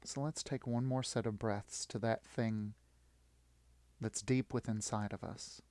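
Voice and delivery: ordinary talk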